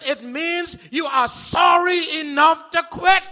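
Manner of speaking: loud, slow, rising preacher shout; booming sermon climax; full-force preaching. Acted. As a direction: preach it out to the church, building to a cry.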